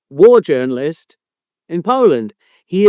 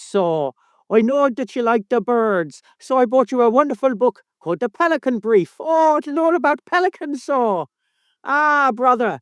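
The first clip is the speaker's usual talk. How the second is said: mock Irish son, pitched up; hammed-up Irish accent; 4 semitones higher than usual. Host mimics co-host giving Christmas books, mock Irish accent. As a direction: put on a broad comic Irish accent. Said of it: "the offensive Irish accent".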